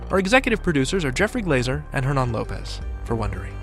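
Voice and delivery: normal talking voice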